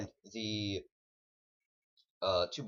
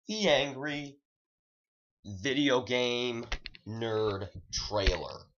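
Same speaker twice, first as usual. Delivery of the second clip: very slow announcement, easing down to the end; dramatic title reveal; 4 semitones higher, 4 dB louder, much slower than usual. Acted. Vlogger getting back to the trailer, dramatic title reveal. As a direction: stretch every word of the title for effect.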